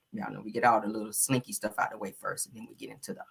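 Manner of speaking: normal talking voice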